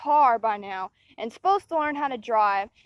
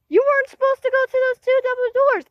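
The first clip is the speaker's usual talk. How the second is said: high, loud, fast mocking teacher voice; whiny sing-song parody of the teacher; 10 semitones higher, 5 dB louder, faster than usual. A girl mocking her teacher who scolded her. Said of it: "our teacher, like, said".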